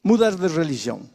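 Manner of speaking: rising, pressing sermon cadence; impassioned pulpit call; fervent preaching. Acted. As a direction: preach it at the flock with urgency. Preacher exhorting the congregation.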